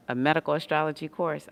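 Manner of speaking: usual speaking voice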